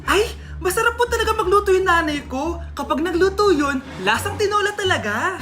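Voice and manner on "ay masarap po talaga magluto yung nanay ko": ordinary delivery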